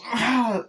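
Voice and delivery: high, sharp cry; hammed-up burst of effort; mock striking yell. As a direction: imitate yelling as you stab back. Victim stabs killer with needle.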